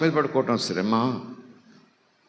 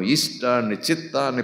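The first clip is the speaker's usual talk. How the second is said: normal talking voice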